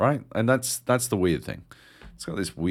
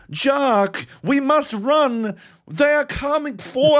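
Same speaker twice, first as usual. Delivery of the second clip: put-on much higher, slow, accent sliding between Boston, Liverpool and South African; a caricature of a badly acted video game companion; mock wobbly game accent; 6 dB louder, slower than usual. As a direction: ham up a voice actor whose accent keeps drifting. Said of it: "bouncing between fucking, like, a Bostonian accent, Liverpool, South African".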